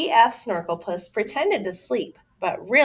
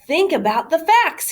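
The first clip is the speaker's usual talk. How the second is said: higher, louder lecturing; scolding; 10 semitones higher, 5 dB louder than usual. Acted. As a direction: scold the kids like a know-it-all. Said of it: "he scolded".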